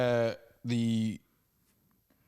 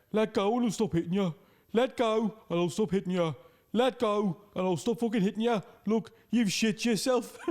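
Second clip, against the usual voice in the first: high, louder put-on regional accent; imitated fighting woman; 10 semitones higher, 4 dB louder than usual. Woman winning a Primark fight orders her opponent, high insistent imitation. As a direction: repeat it insistently in a high mock-English voice. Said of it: "woman who's winning the fight just keeps going".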